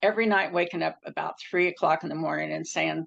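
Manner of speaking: normal talking voice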